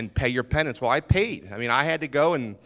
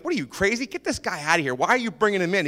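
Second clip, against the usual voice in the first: higher-pitched retold indignation; indignant protest; 6 semitones higher than usual. Retelling objection to partner letting job seeker in, indignant. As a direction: imitate an exasperated objection, pitched up.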